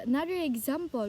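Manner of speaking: ordinary talk, nothing marked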